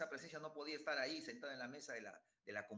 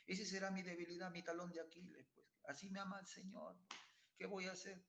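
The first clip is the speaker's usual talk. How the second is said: voz aguda de excusa resignada, más suave; 5 semitones higher, 4 dB quieter than usual. Retold or imitated. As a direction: imita al pecador conformista, agudo y blando. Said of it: "nuestra tonta y triste idea". (imitando con burla al que se excusa)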